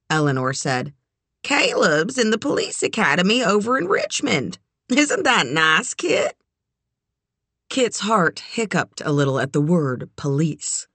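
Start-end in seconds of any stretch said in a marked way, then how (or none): 1.4-6.4 higher, bright proud lady; lightly voiced proud matron; older woman voice. A narrator's reading. Eleanor voiced.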